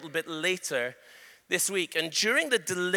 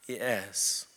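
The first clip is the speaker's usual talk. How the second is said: lower, softer defeated yes; low demon yes; 11 semitones lower, 7 dB quieter than usual. Retold or imitated. Spirit concedes to the preacher, low and soft. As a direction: give a low, beaten yes. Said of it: "And it goes".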